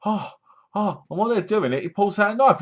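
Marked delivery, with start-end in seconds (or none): 0.0-1.0 high breathy-sounding fake moans; lightly mocked moaning. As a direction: ham up the bedroom moans. Mimicking characters having sex.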